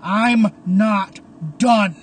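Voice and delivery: loud, drawn-out separate words; defiant roar. A narrator's reading. Caleb erupts from the wreckage, slow defiant roar. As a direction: roar each word slowly. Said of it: "as Caleb erupts from the wreckage".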